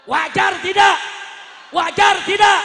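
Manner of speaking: high, full-force shout to the crowd; rallying shout. Real, not acted. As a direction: belt out the question to rouse them. Rousing the crowd. (teriakan lantang membakar massa)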